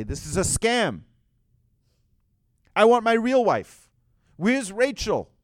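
acted-out indignant yell, high and loud; loud, high-pitched demand; outraged shout. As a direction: shout like a cheated bridegroom. Jacob acted out discovering the scam.

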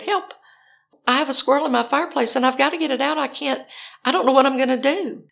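high, rapid call for help; frantic plea. Retold or imitated. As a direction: rattle out the emergency in a rising panic. Begging police for help.

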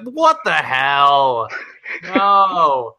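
loud, drawn-out mock disbelief; appalled audience. Imitating reaction videos of Pikachu speaking English, appalled and loud. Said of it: "And everybody's like".

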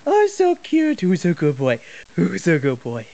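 put-on baby-talk cooing at a puppy. Interviewer hugs the puppy, cooing baby talk. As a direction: coo at the puppy like a pet owner.

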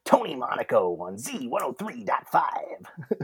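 swooping, hushed radio promo voice; Tony Monaco. Acted. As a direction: croon the station ID, trailing into a whisper. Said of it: "used to be able to do a really good Tony Monaco".

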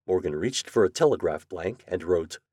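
usual speaking voice

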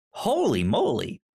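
usual speaking voice

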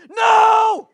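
high, explosive yell; acted exasperated shout. Panicked dad answers his other children, acted exasperated shout. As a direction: cut the kids off with a huge refusal.

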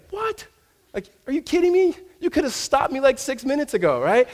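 high, squeaky cry of disbelief; shocked disbelief. Retold or imitated. Preacher replaying his own stunned reaction, high-pitched.

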